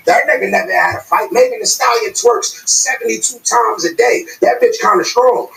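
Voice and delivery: normal talking voice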